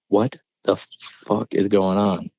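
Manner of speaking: slowed, mildly bewildered retelling; not calm. Retold or imitated. Retelling a reply to the calm cousin, mildly rattled. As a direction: let the disbelief stretch the question a little. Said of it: "and I'm not calm".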